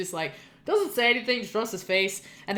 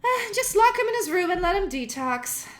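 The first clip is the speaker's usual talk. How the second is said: higher, sneering aunt voice; a higher, dismissive aunt talking over him; condescending aunt; 8 semitones higher than usual. Acted. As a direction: look down your nose at the nephew.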